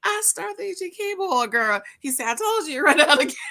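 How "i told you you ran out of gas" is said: fast, high laughing I-told-you; mock laughing gloat. Tow man laughing at her.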